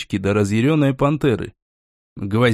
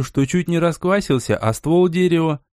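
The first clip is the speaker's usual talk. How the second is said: usual speaking voice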